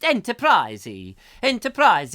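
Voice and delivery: put-on high, loud comic Italian accent; mock Italian. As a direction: punch it out as a hammed-up Italian word. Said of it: "it would be the Italian Enter".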